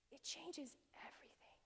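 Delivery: hushed, reverent whisper; soft awestruck whisper; awed whisper. Real, not acted. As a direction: breathe it out like a revelation. Awed emphasis, repeated line.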